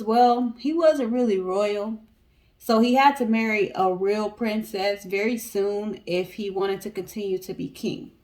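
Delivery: usual speaking voice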